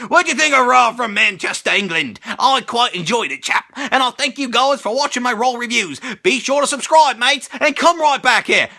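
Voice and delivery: hammed-up fake English accent slipping into Australian; terrible mock-British sign-off; bad British accent. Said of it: "That was the worst British accent ever".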